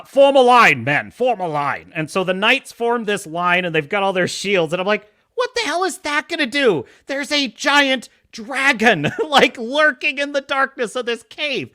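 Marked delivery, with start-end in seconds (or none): 0.0-1.9 lightly acted king's order, loud at first; commanding king. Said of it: "and the king". 5.3-11.7 high, exasperated disbelief; incredulous rant. Acted. Ranting at the king's tactics.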